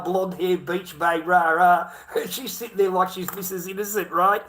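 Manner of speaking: normal talking voice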